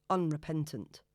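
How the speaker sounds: plain reading voice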